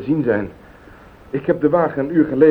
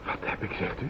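hushed, breathless disbelief; shocked near-whisper; stunned disbelief; 9 semitones lower, 15 dB quieter than usual. Acted. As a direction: barely get the words out in shock. Husband accused of killing neighbour.

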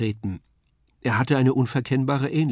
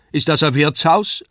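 puppet-clown question, higher and louder; Kasperl asking; 4 semitones higher, 6 dB louder than usual. A narrator's reading. Kasperl asking Wagner about the hospital, raised puppet voice. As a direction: ask eagerly in a raised puppet voice. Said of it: "fragte Kasperle".

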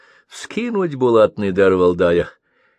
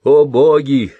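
loud plea; 5 semitones higher, 8 dB louder than usual. Acted. Old man pleading with the sky, loud cry. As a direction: cry out to the heavens, higher and louder. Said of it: "взмолился в небо".

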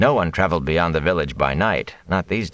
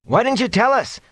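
aggrieved demand. A narrator's reading. Matt demanding of the mayor, aggrieved and high. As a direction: whine it like a wronged boy. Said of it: "demanded in an aggrieved voice".

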